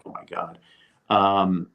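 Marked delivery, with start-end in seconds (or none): 0.1-0.6 breathless starstruck whisper under the breath; hushed oh my God, starstruck; awed whisper. Retold or imitated. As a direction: whisper it, stunned by who's in the room. Starstruck seeing Broadway stars at bar.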